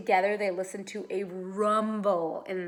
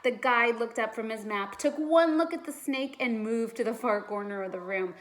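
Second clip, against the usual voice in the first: usual speaking voice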